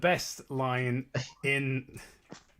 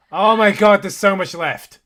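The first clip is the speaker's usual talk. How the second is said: loud, higher cry of dismay; exasperated shout; 7 semitones higher, 14 dB louder than usual. Real, not acted. Reader seeing how much story is left, exasperated shout.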